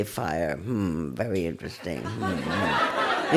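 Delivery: low, soft, amused musing at a fire; mock detached calm. Teacher mocking passive noticing of a house fire. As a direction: hum over it like a bored spectator. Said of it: "you don't say".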